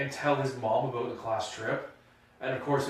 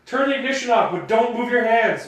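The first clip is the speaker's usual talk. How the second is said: much higher and louder commanding cop; imagined cop barking orders; 11 semitones higher, 14 dB louder than usual. Retold or imitated. Friend picturing the cop, loud mock commands. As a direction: shout the orders like a tense officer. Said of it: "picturing this guy like".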